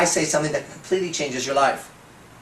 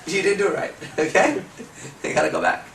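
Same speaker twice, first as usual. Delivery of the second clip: ordinary delivery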